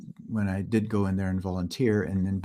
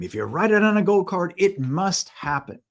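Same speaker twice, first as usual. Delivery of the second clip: Bob Proctor's emphatic claim; 10 semitones higher, 6 dB louder than usual. Retold or imitated. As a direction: proclaim it like a mentor laying down the law. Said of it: "Bob Proctor would say this all the time".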